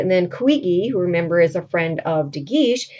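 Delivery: ordinary talk, nothing marked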